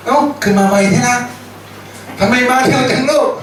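mother laughing at her scared son; amused mother. Retold or imitated. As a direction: tease the boy like a mother who sees him back so soon. Mother surprised son returned fast.

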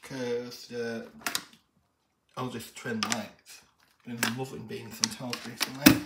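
normal talking voice

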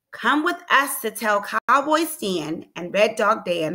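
ordinary delivery, nothing marked

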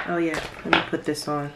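normal talking voice

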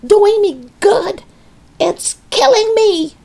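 high, loud outraged protest; screaming. A narrator's reading. Grandma disputing George about medicine, screaming. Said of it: "she screamed".

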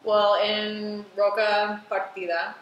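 Roca Partida with a heavy American accent, hard R's; terrible American accent. Shown as a demonstration. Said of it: "that was a terrible American accent".

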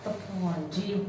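a low, hushed plea to God under her breath; muttered prayer. Acted. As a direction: sigh it to yourself, barely audible. Wife sighs to herself alone. (ਭਗਵਾਨ ਅੱਗੇ ਹੌਲੀ ਜਿਹੀ ਅਰਜ਼)

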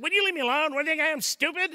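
loud, high, irritated retort; snapping back angrily. Pretend. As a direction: bite back at the helpful coworker. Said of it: "if I respond negatively like that".

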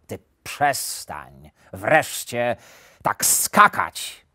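slow, emphatic annoyed wolf; exasperated, heavily spaced scolding; irritated growl. A narrator's reading. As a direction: growl it out word by word, fed up. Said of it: "warknął zdenerwowany wilk".

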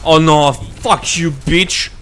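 drawn-out annoyed swearing; slow, loud, exasperated cursing; frustrated curse. Real, not acted. Frustrated at game enemy.

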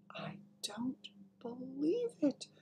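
slow, high, whispery murmur; stunned murmur. A narrator's reading. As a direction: murmur it softly in disbelief. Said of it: "the Queen was murmuring".

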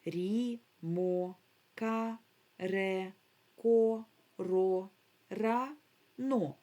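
each syllable held evenly and set apart; separate syllables. Shown as a demonstration. Teacher showing children the syllables, each sounded separately. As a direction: read the syllables one by one, with gaps. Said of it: "Подивіться на склади".

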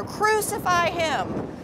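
very high, drawn-out crowd cry. A narrator's reading. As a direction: stretch it out high like an insistent mob. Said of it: "the mob roared even louder".

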